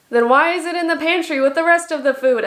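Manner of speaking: high, emphatic exclaimed question; mock outrage. Vlogger reading a comment full of exclamation marks, mock outraged. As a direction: read it out as an over-exclaimed demand.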